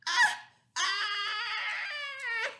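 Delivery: very high, loud mock scream; hammed-up fright; scared scream. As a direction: shriek like a frightened monster. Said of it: "if I were a scared monster".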